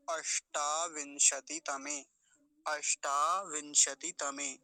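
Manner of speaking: अष्टाविंशति तमे slowly, with long टा; careful अष्टाविंशति तमे, every syllable sounded; model pronunciation. Shown as a demonstration. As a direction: draw out अष्टा, then विंशति तमे with full श. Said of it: "ऐसा हो गया इसका उच्चारण".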